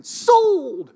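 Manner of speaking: a sudden high, loud "Sold!" like a shout from the pews; mock church shout. Pastor mimicking a worshipper's shout in church, loud and high.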